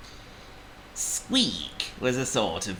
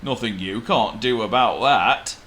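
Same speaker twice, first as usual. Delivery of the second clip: louder elephant voice; Trumpet; 2 semitones higher, 6 dB louder than usual. A narrator's reading. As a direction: dismiss it as a big elephant. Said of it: "said Trumpet".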